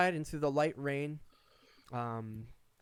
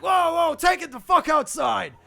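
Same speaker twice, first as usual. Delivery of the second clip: barkeeper yelling; 13 semitones higher, 11 dB louder than usual. Acted. A barkeeper breaks up the fight.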